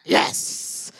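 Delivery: voiceless, breath-only celebration; triumphant yes. Retold or imitated. Woman recalling her reaction to God's help, whispered triumph. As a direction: whisper a retold cry of victory.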